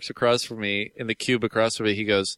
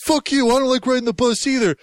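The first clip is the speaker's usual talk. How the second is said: retold picking a fight; 9 semitones higher than usual. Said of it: "trying to pick a fight".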